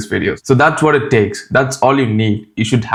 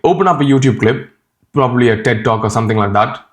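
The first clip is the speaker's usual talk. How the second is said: normal talking voice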